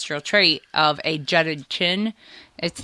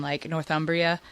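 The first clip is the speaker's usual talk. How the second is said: usual speaking voice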